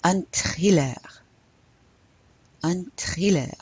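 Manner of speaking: THRILLER said the French way, "tree-LAIR"; French-accented "thriller", no English TH. Shown as a demonstration. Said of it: "pronounce it with a French accent".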